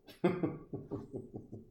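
short burst of wheezy giggling; real voiceless chuckle at your own joke; tickled laughter. Real, not acted. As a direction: laugh it out in quick puffs. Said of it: "I caught myself there with a little bit of hoo hoo ha ha".

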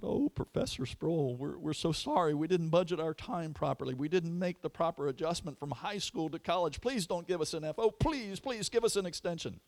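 high, pleading student voices. Retold or imitated. Students begging the professor, high and pleading. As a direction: beg the professor like remorseful students. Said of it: "They cried out".

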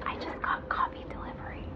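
hushed, voiceless aside; whispered aside. Real, not acted. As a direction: whisper it as a quick side note. Whispered aside about delivery.

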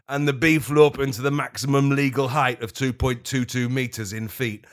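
normal talking voice

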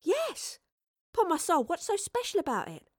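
put-on very high, impatient girl's voice; exasperated Astrid. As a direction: demand it high, out of patience. Astrid impatiently demands an answer.

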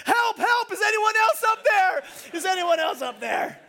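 high, very loud hammed-up shout; yelling for help. Jack refuses God's answer and calls for someone else, high comic yelling. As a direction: bellow up for another rescuer. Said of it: "Jack begins to yell".

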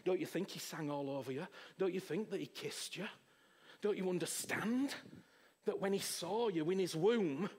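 ordinary talk, nothing marked

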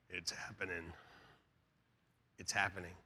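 very soft and low; hushed aside. Retold or imitated. Husband confiding to his wife in church, hushed aside. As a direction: murmur it low, as a secret.

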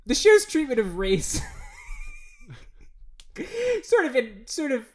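high, loud, breaking into laughter; real laughing. Real, not acted. Commenting on the show's treatment of race, cracking up. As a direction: fight through laughter to make the point.

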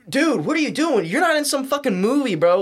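high-pitched, incredulous pleading; exasperated plea. Retold or imitated. Pleading with brother to come back, exasperated.

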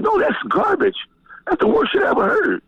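high, scornful put-down; retold dismissal. Quoting himself dissing [name].

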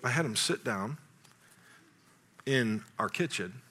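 ordinary delivery

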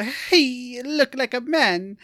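high, slow caricature voice; Missus Swan. Retold or imitated. Co-host explaining a TV sketch, slow high character impression. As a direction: imitate a sketch-show character, drawn out and high.

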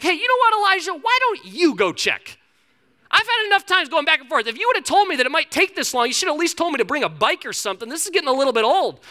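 high, put-on complaint of a fed-up servant; exasperated servant. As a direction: protest loudly, pitch jumping up.

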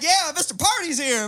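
a high, loud, cocky announcement; party guy. Retold or imitated. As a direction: announce yourself like the life of the party. Retelling his own party entrance.

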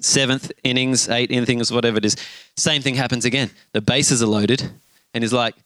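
ordinary delivery

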